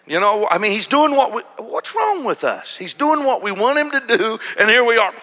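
loud, high preacher's cry; preaching at full force. Real, not acted. Preacher telling his church the story, at full force. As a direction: lift it like the Sunday sermon climax.

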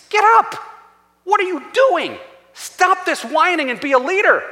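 acted-out indignant command; exasperated scolding. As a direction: scold a leader who has given up. God scolds Joshua, acted.

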